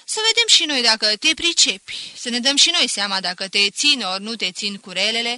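loud, sarcastic schoolmaster; mock him with overdone politeness; teacher taunting the pupil at the desk. Teacher mocking the boy.

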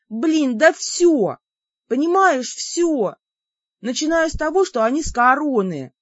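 high, insistent outburst; exasperated protest. Acted. Heroine answers what bothers her about them, exasperated.

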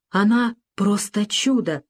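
ordinary delivery

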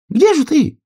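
very high, loud squirrel voice; high-pitched, pressing call; impatient squirrel. A narrator's reading. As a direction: hurry someone along in a high squeal. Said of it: "торопила белка".

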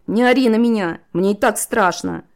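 high, loud, scared protest; rattled heroine yelling back; frightened yell. A narrator's reading. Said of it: "крикнула Лира".

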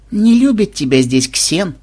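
slow, teasing altered voice; snide remark. Acted. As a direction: needle him sweetly. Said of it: "ехидно заметила Мелиса". (ехидно, с подколкой)

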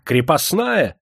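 high, demanding «Крепостная?»; imperious question. A narrator's reading. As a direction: bark it as a haughty question. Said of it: "заорал дворянчик". (грозный вопрос барина)

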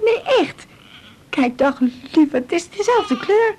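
put-on high singsong to the baby; baby talk. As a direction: lift it up for the little one. (hoog zangerig babypraatje)

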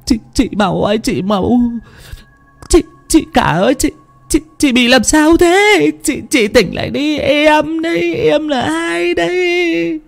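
high, stammering, sobbing plea; tearful pleading. A narrator's reading. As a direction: beg for your life, stretching the ends into wails. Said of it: "mồm vẫn không ngừng kêu than".

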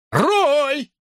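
high called name; a shouted name; calling out. A narrator's reading. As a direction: call across the lot. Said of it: "позвал Хен".

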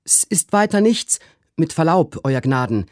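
high-pitched deferential servant; servant Trim calling out. A narrator's reading. Servant reassures the startled master, high-pitched and deferential. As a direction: call out high to your master, respectfully. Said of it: "rief Trim".

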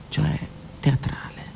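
plain reading voice

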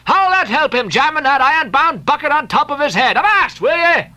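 high, scolding shouted rebuke. A narrator's reading. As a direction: bark at him to stop at once. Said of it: "cried Stubb".

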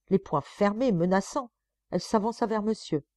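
usual speaking voice

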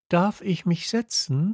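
put-on high, hesitant child's question; little prince asking the king timidly. A narrator's reading. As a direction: ask shyly, half afraid to disturb. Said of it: "fragte der kleine Prinz zaghaft".

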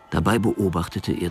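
ordinary talk

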